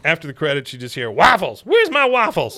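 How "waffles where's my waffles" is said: high, loud mock holler; demanding retold yell. Character yelling after the credits, demanding holler. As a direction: bellow for breakfast as the cartoon character.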